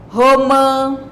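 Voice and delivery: "romã" as two even beats, RO-MÃ, held level; divided into syllables. Shown as a demonstration. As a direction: sound out both syllables of "romã" firmly. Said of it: "as palavras em sílabas". ("romã" dividido em sílabas)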